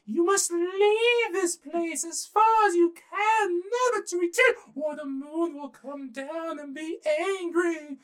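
high, slow, squeaky rabbit warning; little rabbit's shrill, stretched-out command. Acted. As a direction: pipe out a grave threat as a tiny rabbit. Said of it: "And the little rabbit said".

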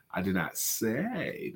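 slow, soft, with "say" drawn out and leaned on; hint that it was implied, not said; stress on SAY. Shown as a demonstration. Showing that he only implied it, stress on "say". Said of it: "I could have implied it".

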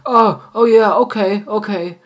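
high, loud startled okays; hammed-up oh-right-okay; flustered realisation. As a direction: caught off guard, hurry to agree. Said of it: "You're like".

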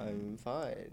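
soft, hushed question; mock ASMR. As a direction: ask it in a playful ASMR murmur. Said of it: "A S M R part three".

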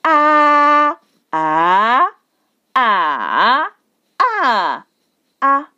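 "a" level, rising, dipping, then short; Mandarin tone drill. Shown as a demonstration. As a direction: run "a" through the tones, each take separate. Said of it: "I put different tones on them".

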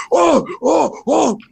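high-pitched exasperated "oh", repeated; imitated whiny straining grunts; mock frustrated groans. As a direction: groan in rising frustration, hammed up. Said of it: "like, frustrated".

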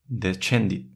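descendit with a ch sound; Latin SC as ch. Shown as a demonstration. Showing SC before E as ch. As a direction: say de-chen-dit. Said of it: "S C seguida de E o I se pronuncia también como che".

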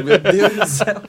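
exasperated friend. Retold or imitated. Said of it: "o Álvaro tá tipo".

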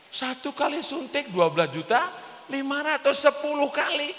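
high-pitched, mock-aghast repeat of the price; retold astonishment. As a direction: exclaim it high, as if stunned by the sum. Reacts to the injection price. (kaget menirukan, nada tinggi)

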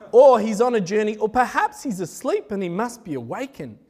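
ordinary talk, nothing marked

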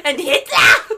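put-on loud, high German-accented shout; mock German accent. Podcaster reprising demon line for co-host, loud German accent. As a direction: laughingly blurt it in a German accent. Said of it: "that little German accent".

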